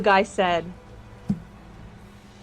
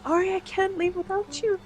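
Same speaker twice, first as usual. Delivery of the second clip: soft, high, desperate plea; tearful pleading; 11 semitones higher, 8 dB quieter than usual. Acted. Sister speaking to the dying girl, pleading softly. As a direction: quietly beg her not to go.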